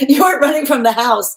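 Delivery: laughing. Real, not acted. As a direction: burst out laughing as you repeat it.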